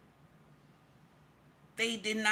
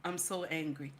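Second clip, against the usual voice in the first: held-back anger; 4 semitones lower, 5 dB quieter than usual. Real, not acted. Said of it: "I'm trying to calm down".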